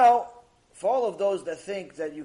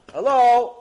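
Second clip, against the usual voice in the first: loud, raised hello at a door; calling out; 5 semitones higher, 12 dB louder than usual. Acted. Tears knocking at Heaven's gate, calling out. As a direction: call out to whoever is inside.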